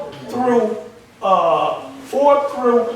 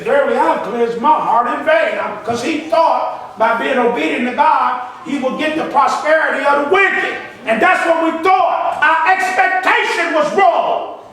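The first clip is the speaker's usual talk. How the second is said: raised, forceful preacher's voice; full-force preaching; 5 semitones higher, 5 dB louder than usual. Real, not acted. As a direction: build higher and louder as you preach it.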